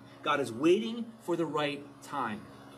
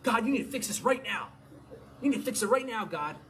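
higher, pleading and insistent; imitating an impatient demand on God; 6 semitones higher than usual. Believer urging God to act immediately, impatient demand. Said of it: "we grow impatient".

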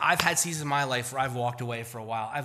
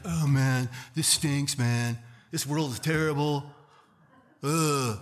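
gloomy Eeyore moan; slower than usual. Acted. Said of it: "Eeyore Christians".

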